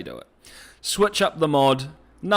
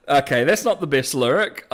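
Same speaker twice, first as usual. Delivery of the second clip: ordinary delivery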